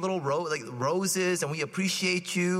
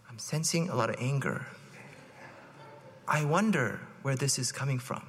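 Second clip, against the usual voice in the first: low, softer calm counselor tone; calm, soothing; 4 semitones lower, 4 dB quieter than usual. Shown as a demonstration. Husband calmly answering his angry wife, soothing. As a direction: soothe like a therapist, unruffled. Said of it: "say it in a nice, calm voice".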